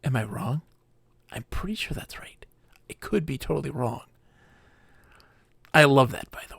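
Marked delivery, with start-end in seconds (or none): 1.3-4.0 whisper. Real, not acted. Unsure aside to chat.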